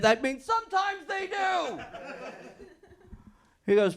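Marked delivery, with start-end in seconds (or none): 0.0-1.8 1 panicked realisation. Retold or imitated.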